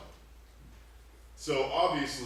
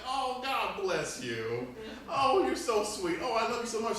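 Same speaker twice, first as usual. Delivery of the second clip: slow, doting grandma voice, higher; tipsy grandma; 10 semitones higher, 4 dB louder than usual. Retold or imitated. Grandma on the couch doting on her grandson, slow and cooing. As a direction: coo at the boy, drawn out. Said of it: "she'd be like".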